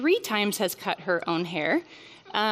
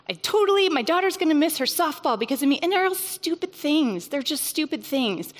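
a mom listing her failures in a rising panic; 6 semitones higher than usual. Real, not acted. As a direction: spill out the panic all at once. Piling mom guilt, panicking.

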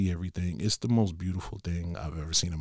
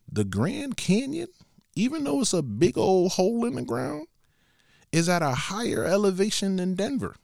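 high, amazed telling; 10 semitones higher than usual. Real, not acted. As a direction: pitch way up in disbelief. Marvelling at Grand Canyon elevation.

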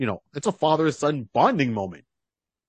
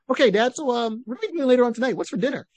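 put-on high, quick kid chatter; breezy high-pitched boy talking to Dad; hunter's young son; 11 semitones higher, faster than usual. Son chats casually after shooting.

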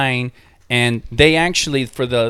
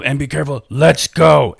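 in character guide; 2 semitones higher, 4 dB louder than usual. Retold or imitated. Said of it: "they were all in character".

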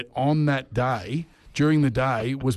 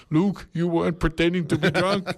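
high South African boss voice; South African accent; 6 semitones higher than usual. Retold or imitated. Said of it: "do his South African accent".

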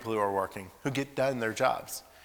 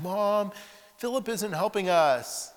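higher, slow tattling whine; kid tattling; 9 semitones higher, 4 dB louder, slower than usual. Retold or imitated. Boys tattling to Mom about Philip, whining. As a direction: tell on your brother.

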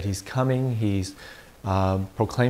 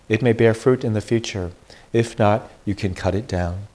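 louder, formal proclamation from the pulpit; Gospel reading; 4 dB louder than usual. Acted. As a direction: read scripture to the congregation.

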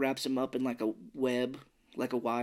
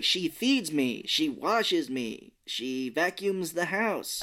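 very slow, each phrase spelled out; slow miming explanation; 3 semitones higher, much slower than usual. Retold or imitated. Milo telling the monkey creatures about his mom, very slow. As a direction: lay it out slowly like talking to someone who can't understand. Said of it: "It's like".

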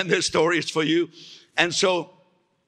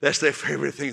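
normal talking voice

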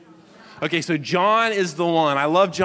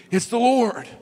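higher, louder blurt; excited exclamation; 8 semitones higher, 4 dB louder than usual. Retold or imitated. Impulsive Peter recognizing Jesus, excited exclamation. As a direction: Peter bursting out with it, eager. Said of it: "And then you have Peter".

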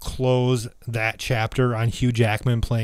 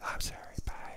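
tiny whispered apology; whispering; 19 dB quieter, faster than usual. Real, not acted. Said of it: "Why are we whispering?".

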